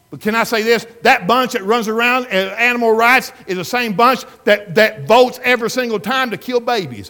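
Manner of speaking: loud, high, driving pulpit voice; full-force preaching. Acted. Preacher to his congregation, loud and driving. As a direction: hammer the point home to the congregation.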